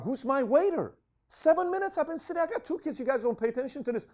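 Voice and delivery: high, exasperated retold rant; indignant complaint. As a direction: complain about waiting with two kids. Complaining about slow service.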